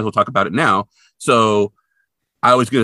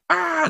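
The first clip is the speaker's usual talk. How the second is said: very high cartoon yelp; imitated scream of panic; mock panicked shriek. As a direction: freak out at the lion. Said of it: "loses his mind".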